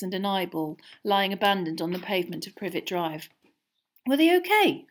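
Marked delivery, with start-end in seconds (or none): 4.1-4.9 higher, louder startled question; a startled boy, high and loud. A narrator's reading. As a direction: blurt it out in shock. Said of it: "he asked, startled".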